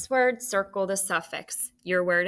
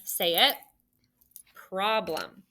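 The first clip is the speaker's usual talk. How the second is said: usual speaking voice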